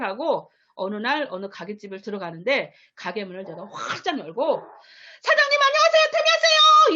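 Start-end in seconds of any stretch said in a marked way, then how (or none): 5.2-7.0 high, very loud shouted greeting; bursting through the door with a huge shout. Retold or imitated. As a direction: yell it at the shop owner with full resolve. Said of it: "이 소리를 제가 했어요. 진짜 이렇게 소리를 질렀어요".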